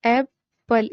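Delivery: "एप्पल" with a gap between its two syllables. Shown as a demonstration. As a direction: split "एप्पल" into two distinct beats. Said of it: "दो vowel sounds हैं". ("एप्पल" के दोनों हिस्से अलग-अलग)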